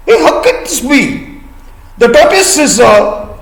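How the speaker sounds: a king who can't believe his eyes; lightly voiced amazed king; astonished king. King astonished at flying tortoise.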